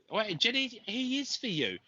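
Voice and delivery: high, sudden realisation; surprised all at once. Real, not acted. Sudden realisation, much higher.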